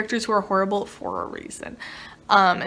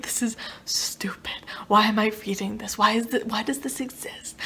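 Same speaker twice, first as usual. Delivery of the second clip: hushed exasperated whisper; 5 dB quieter than usual. Retold or imitated. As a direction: whisper it in disbelief. Quoted inner reaction.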